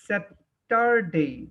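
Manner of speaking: slow, drawn-out Saturday; teacher modelling Saturday slowly; slow model word. Shown as a demonstration. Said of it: "খেয়াল করো".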